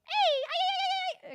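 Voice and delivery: very high, loud mock mariachi cry. Streamer jokingly doing a mariachi holler. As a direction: belt out a cartoon ay-ay-ay. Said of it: "bordering on stereotype".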